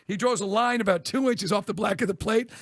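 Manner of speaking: ordinary talk, nothing marked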